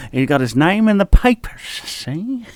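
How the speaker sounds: a hammed-up old-movie private eye, rising to a high "see?"; mock New York PI. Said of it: "What's the other one you like to do?".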